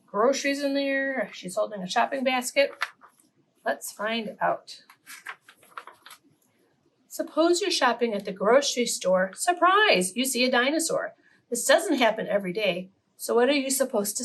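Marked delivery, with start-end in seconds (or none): 3.7-4.8 slow, softer, drawn out. Shown as a demonstration. Host inviting children to guess, slow and soft. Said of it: "repeated invitation to find out". 9.3-10.2 surprise. Acted. Storyteller reveals the dinosaur.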